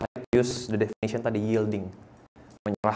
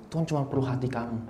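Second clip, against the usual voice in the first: ordinary talk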